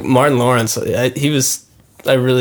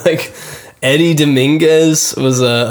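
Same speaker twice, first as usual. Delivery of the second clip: usual speaking voice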